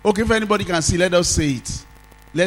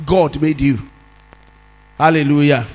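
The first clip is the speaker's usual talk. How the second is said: emphatic preacher, stretching key words; forceful preaching; 2 semitones higher, 5 dB louder than usual. Real, not acted. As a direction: preach it out with louder, weighty emphasis. Preaches after quieter anecdote.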